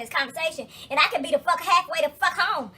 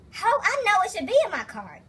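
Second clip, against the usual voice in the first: indignant retort; 6 semitones higher than usual. Retold or imitated.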